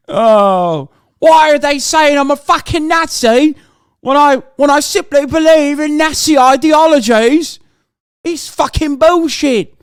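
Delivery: British accent, sneering with fake outrage; mock British lad accent. Streamer mocking a far-right Brit in a British accent. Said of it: "Why are you so good with that accent?".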